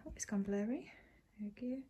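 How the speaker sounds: unvoiced soft whisper; hushed aside. Real, not acted. Whispering in the Airbnb.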